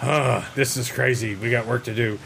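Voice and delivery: gruff annoyed superhero; disgruntled Batman. Retold or imitated. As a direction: grumble it like a fed-up Batman. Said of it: "he acted like he was disgruntled".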